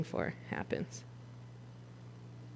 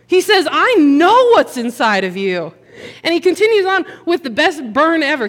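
higher, louder fired-up sermon; full-force preaching; 5 semitones higher, 6 dB louder than usual. Acted. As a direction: drive the point home to the congregation.

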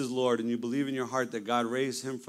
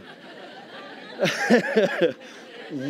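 a high burst of laughter; overwhelmed laugh; 9 semitones higher than usual. Real, not acted. Recalling the Holy Spirit falling on him, high overwhelmed laugh.

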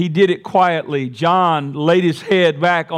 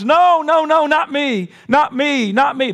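Peter protesting; 11 semitones higher than usual. Acted. Peter insisting to Jesus he won't deny him, high protest. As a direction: protest like a disciple who can't believe it.

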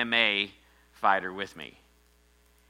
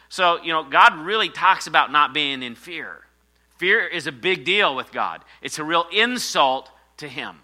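raised, loud preaching; 6 semitones higher, 8 dB louder than usual. Acted. Pastor rousing the congregation against fear, loud preaching. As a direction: drive the point home to the church.

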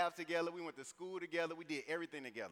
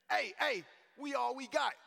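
high, loud rallying call; imitated street call, pitched way up; 10 semitones higher, 6 dB louder than usual. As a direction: shout it out to summon the crew. Rallying his friends to a fight.

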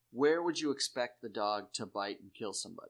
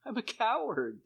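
much higher, a mock whimpering confession; whiny coward voice; 12 semitones higher, 3 dB louder than usual. Sam confessing to the bullies, mock whiny coward. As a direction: play the helpless coward for laughs.

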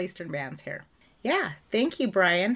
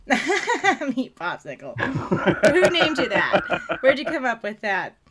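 laughing; 3 semitones higher, 8 dB louder than usual. Real, not acted. Laughing at the nickname.

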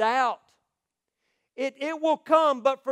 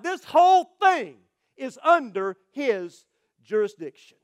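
preaching at full force; 6 dB louder than usual. Acted. Preacher proclaiming to his congregation that God never changes, at full force. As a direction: declare it like a pulpit climax.

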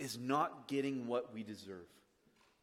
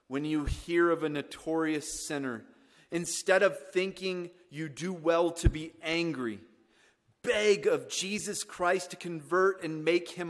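loud, raised pulpit delivery; preaching a quotation; 5 semitones higher, 8 dB louder than usual. Acted. Preacher quoting George Whitfield to the congregation, forceful. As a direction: proclaim the quote to the room with force.

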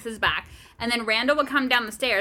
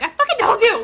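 high, loud half-laughing scold; mock scolding; 10 semitones higher, 7 dB louder than usual. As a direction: snap it at the kid, half laughing. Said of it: "I'm like".

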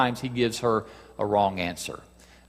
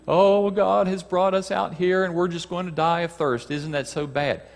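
complaining Hebrew people; 7 semitones higher than usual. Acted. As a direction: grumble as the people in the wilderness. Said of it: "and what do they do?".

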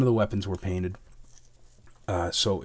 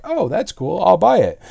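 eager buyer; 9 semitones higher, 9 dB louder than usual. Retold or imitated. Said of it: "everybody was like".

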